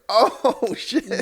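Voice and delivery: high startled gasp into a laugh; sudden high shock, laughing; shocked exclamation. Retold or imitated. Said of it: "and you're like".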